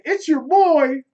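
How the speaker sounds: loud, high hype shout; hype catchphrase. Shown as a demonstration. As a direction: shout it out to the crowd. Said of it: "Say what's up to the people".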